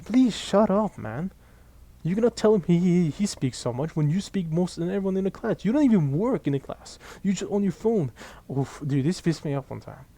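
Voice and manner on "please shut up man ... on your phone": retold telling-off. Telling off a loud classmate.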